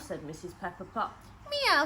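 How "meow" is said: high, loud meow; a bright squeaky cat cry, higher and louder. Acted. Cat meowing at Missus Pepperpot, high and loud.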